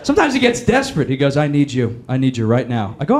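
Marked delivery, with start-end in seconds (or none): 1.4-2.9 a lightly voiced clingy ex begging; the president begging the comic, low and needy.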